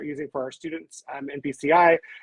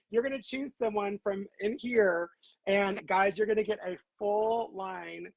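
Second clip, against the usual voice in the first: ordinary talk, nothing marked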